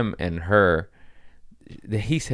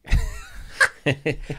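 laugh; 8 semitones higher than usual. Real, not acted. Laughing at own joke.

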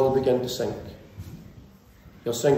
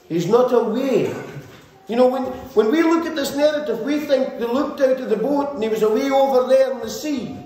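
raised, driving sermon voice at full force; fiery preaching; 10 semitones higher than usual. Acted. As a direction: preach it out to the congregation, pushing higher.

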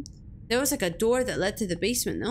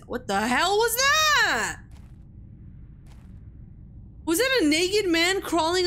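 startled; 7 semitones higher than usual. Real, not acted. Startled by something in horror game.

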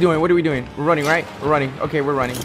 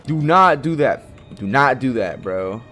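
nervous pleading; 4 semitones higher, 8 dB louder than usual. Real, not acted. Pleading with game creature.

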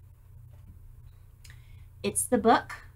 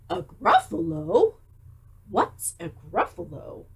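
1 puzzled fox; 2 semitones lower than usual. Acted. Fox asks.

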